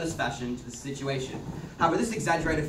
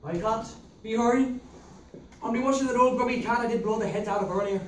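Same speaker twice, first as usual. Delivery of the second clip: put-on Irish accent; 7 semitones higher than usual. Man in Irish accent fussing over a bloodied girl. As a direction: play it broad and rural Irish.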